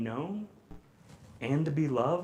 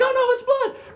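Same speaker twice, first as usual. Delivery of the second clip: panicked parent; 6 dB louder than usual. Retold or imitated. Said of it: "They're like".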